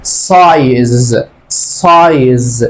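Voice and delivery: سايز stretched out very slowly, the long English I clearly drawn as "aay"; a teacher sounding out سايز at half speed; slow teaching pronunciation. Shown as a demonstration. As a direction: model سايز slowly for the class, leaning on the "aay". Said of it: "أول كلمة هقول إيه؟".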